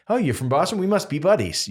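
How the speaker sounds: high, naive instant-buddy voice; mock eagerness. Mocking instant friendship over accent.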